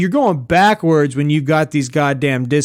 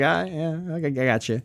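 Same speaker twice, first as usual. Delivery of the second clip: subdued; 8 dB quieter than usual. Retold or imitated. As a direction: quietly back down, barely committing. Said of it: "I was subdued in my response".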